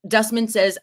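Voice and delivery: usual speaking voice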